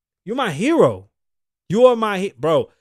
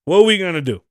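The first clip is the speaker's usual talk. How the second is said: mock challenge; 6 dB louder than usual. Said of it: "and said".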